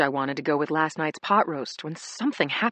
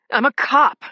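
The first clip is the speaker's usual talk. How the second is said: loud, high command; shouting; 7 semitones higher, 9 dB louder than usual. A narrator's reading. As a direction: yell it to drive them back. Warding off attackers.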